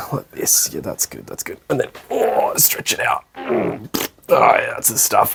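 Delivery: whispered self-satisfied settling; mock a student getting comfy, all in breath; soft lazy sigh of contentment, unvoiced.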